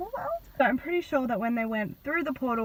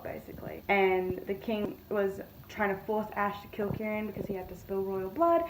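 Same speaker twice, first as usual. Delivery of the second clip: ordinary talk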